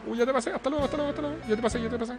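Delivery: high, soft teasing singsong; sing-song taunt. Acted. Taunting rival after passing.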